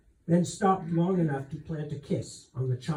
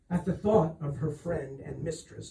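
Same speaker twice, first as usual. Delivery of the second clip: usual speaking voice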